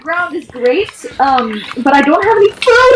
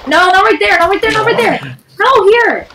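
high, insistent objection; insistent correcting; 7 semitones higher than usual. Real, not acted. Player tells another where not to build, high and insistent. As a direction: object urgently with pitch jumping up.

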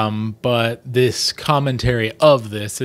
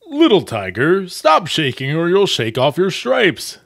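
higher, playful tiger dad; teasing father voice; 6 semitones higher, 4 dB louder than usual. Acted. Tiger father teases his shaking son, playful. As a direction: tease the little one kindly. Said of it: "said his father".

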